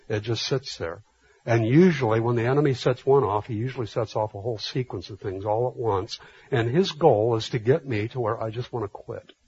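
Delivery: ordinary delivery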